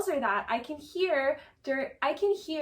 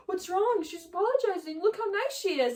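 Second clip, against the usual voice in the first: high, coaxing mock concern; Schwartz, condescending; 6 semitones higher than usual. Schwartz defending Raquel to Katie, mock condescending. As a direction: imitate someone patronizing in a raised voice. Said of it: "And Schwartz is like".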